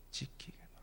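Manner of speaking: soft whispered echo of 지키겠노라; hushed repeat. Real, not acted. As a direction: breathe 지키겠노라 quietly. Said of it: "whispered echo".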